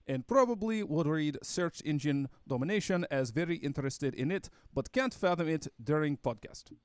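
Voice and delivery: put-on Russian accent for reading aloud. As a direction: read the broken English in a mock Russian accent. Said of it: "try to read your stereotypical Russian accent".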